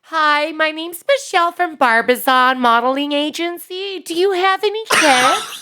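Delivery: very high put-on girl voice; agency caller in a convincing female falsetto; Michelle from Barbizon. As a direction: pitch it up into a girly phone-pitch voice. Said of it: "it's like the girl voice that I do".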